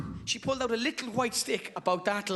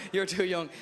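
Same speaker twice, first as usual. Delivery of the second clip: ordinary talk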